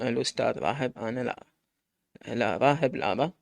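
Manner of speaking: plain reading voice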